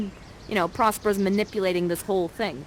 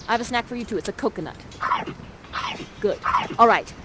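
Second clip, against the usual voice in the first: ordinary delivery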